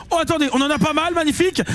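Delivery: excited. Real, not acted. Big multipliers landing.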